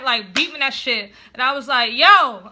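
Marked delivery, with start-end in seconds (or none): 1.9-2.5 shouted "yo", much louder; retold yelling. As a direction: call it out as if to someone indoors. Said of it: "I'm just yelling like".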